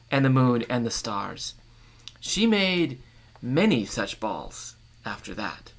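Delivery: measured storytelling read; story narration. A narrator's reading. Reader sharing a northern tale with listeners, storytelling voice. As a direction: read the tale aloud to the group.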